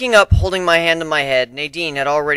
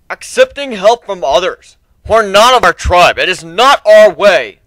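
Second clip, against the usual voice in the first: high, loud, slow voice of tradition; a proud warrior refusing help; objecting tribesman; 7 semitones higher, 11 dB louder than usual. Acted.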